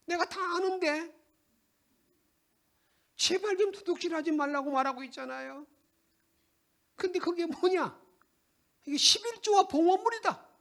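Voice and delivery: high, sharp scolding; stern rebuke. Acted. The Lord rebuking the people sternly. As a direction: rebuke them harshly. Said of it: "이렇게 주님 아주 호되게".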